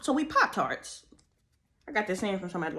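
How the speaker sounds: ordinary talk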